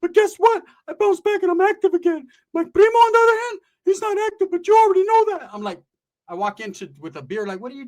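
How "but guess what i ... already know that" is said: high, loud, whiny cousin voice; pitched-up loud buddy complaining about his girl; Ricky's voice. Retold or imitated. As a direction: squeak it out like a worried goofball. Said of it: "Ricky's over there telling".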